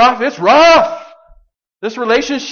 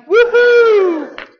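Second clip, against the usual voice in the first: a high, loud celebratory whoop; joyful whoop; 6 dB louder than usual. Real, not acted. Preacher whooping for joy at grace. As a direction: cheer out the good news. Preacher cheers at good news.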